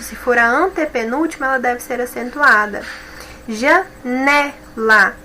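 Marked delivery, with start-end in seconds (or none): 3.5-5.1 "Janela" very slow, "ne" stressed; slow stressed demo. Shown as a demonstration. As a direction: draw out "Janela", leaning on "ne". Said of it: "O né é a sílaba mais forte". ("Janela" bem devagar, com força no "ne")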